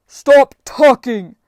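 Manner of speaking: slow, very loud, high bellow; furious booming command; enraged giant's shout. Acted. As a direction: roar it to cut them off. Enraged giant interrupts the bargaining.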